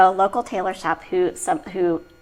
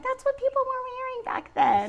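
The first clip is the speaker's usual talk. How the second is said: mock apology; 4 dB quieter than usual. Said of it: "I'm like".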